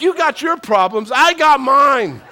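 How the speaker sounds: loud, high comic comeback; hammed-up kid's retort, drawn out at the end; mock indignant child. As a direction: belt it out as a fed-up little kid. Child's comeback acted for laughs.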